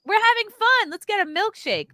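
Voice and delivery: high, playful mock excitement; giddy YOLO voice. Voicing friends who want to reward themselves, mock giddy. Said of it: "spirit of yolo".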